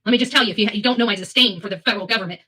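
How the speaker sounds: ordinary delivery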